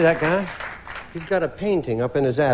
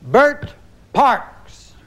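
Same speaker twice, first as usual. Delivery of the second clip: game show host; 11 semitones higher than usual. Real, not acted. Host calls answer to board.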